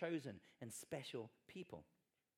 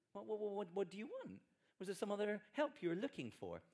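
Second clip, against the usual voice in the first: mock fussy waiter; 7 semitones higher than usual. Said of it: "we're like".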